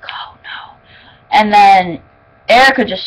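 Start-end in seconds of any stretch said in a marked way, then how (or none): 0.0-0.8 a breathless whisper; whispered dread. Retold or imitated. Reliving a creepy scene for viewers, whispered. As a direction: whisper it like you're watching in horror. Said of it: "And I was like".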